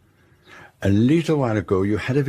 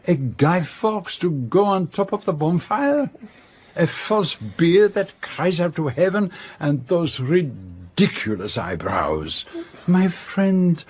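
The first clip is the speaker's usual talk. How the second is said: indignant scolding; 8 semitones higher than usual. Acted. Poirot scolds the ridiculous disguise.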